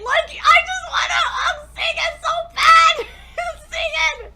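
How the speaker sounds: high, loud tearful plea; sobbing. Real, not acted. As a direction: wail the wish through tears. Sobbing desire to hug character.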